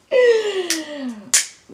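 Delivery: a bright high burst of laughter. Real, not acted. Laughs after the warning.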